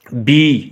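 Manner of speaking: बी with a drawn-out ee; long vowel. Shown as a demonstration. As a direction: hold the ee in बी. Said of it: "ये देखें आवाज़ लंबा". (बी में ई को लंबा करके)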